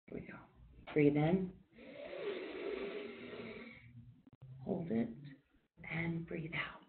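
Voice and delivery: soft, calm breathing cues with long pauses; quiet meditation guide counting breaths; guided breathing. Acted. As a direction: ease the group through each breath, gently. Leads a guided breathing exercise.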